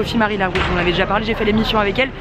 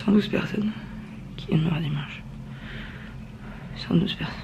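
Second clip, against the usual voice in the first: whispered; 6 semitones lower, 9 dB quieter than usual. Real, not acted. Whispers the news.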